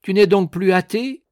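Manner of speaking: high, loud incredulous question; pitched right up in amazement; astonished exclamation. A narrator's reading. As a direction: cry out in disbelief. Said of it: "s'écria Béville".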